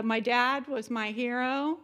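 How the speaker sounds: ordinary talk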